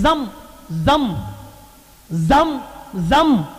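drawn-out calls with swooping pitch; mock cries. Preacher imitating Hajra telling the water to stop, drawn-out mock cries. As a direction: imitate crying out to the water, stretching each word. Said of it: "चीख चीख कर कहने लगी".

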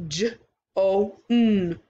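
"J O N" split into separate sounds; segmented sounds. Shown as a demonstration. Teacher segmenting for children, sound by sound. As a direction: sound out each part of "J O N" apart. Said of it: "three sounds".

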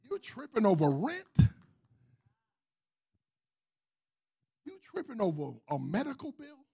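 rising, shouting preacher's list; preaching. Acted. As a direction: build it up to a shout. Building list of blessings.

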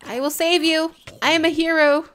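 loud proclamation as a hero; boasting to the rescued duck; mock heroic boast. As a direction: puff up like a hero.

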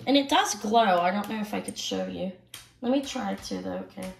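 talking around a cough drop in the mouth. Real, not acted. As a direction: speak with a lozenge in your mouth. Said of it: "I do have a cough drop in my mouth".

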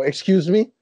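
a startled player demanding an explanation; retold shock. Retold outburst at being told he can't come.

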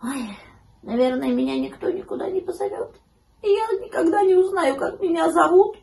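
high, plaintive puppy voice; mock tearful little pup; sad whimpering puppy. A narrator's reading. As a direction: start small, rise to a high wail. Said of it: "всхлипывал грустный щенок".

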